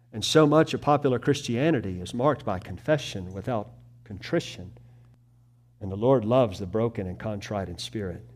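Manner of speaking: ordinary talk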